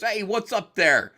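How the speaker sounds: loud, high-pitched tough-guy heckle. Retold or imitated. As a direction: call it out big and high, like a prank caller. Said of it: "the guy who goes".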